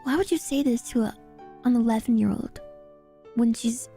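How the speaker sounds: ordinary delivery, nothing marked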